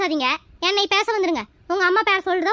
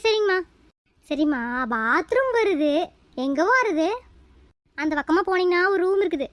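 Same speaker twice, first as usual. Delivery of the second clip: ordinary talk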